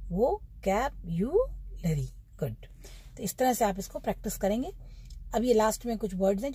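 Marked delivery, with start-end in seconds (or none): 0.1-2.1 वो कैप्युलरी slowly in separate syllables; drawn-out teaching model of वो कैप्युलरी; repeat-after-me model. Shown as a demonstration. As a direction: drill वो कैप्युलरी beat by beat. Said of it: "बोलो".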